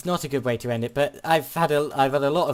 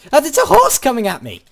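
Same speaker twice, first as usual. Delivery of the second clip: alarmed shout; 10 semitones higher, 8 dB louder than usual. Real, not acted. Horse charging at him in game.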